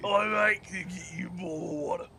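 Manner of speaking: broad, hammed-up British accent; exaggerated British parody; mock British accent. Shown as a demonstration. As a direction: play up the British vowels. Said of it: "I can do a British accent".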